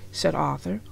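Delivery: plain reading voice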